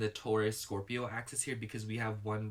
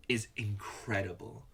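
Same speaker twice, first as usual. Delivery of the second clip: normal talking voice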